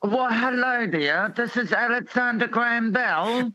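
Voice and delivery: a light hint of a proper English accent. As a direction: put on a mildly official English phone voice. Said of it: "You want me to do an English accent?".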